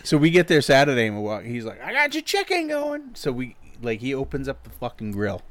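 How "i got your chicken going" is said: high put-on Kenny voice. Friend retelling what the grill guy said, high put-on voice. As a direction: squeeze the voice up high like an old cook.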